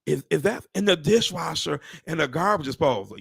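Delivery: barely daring to ask, slowed right down; stunned at the new condo's central air; awed disbelief. Retold or imitated. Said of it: "I was like this".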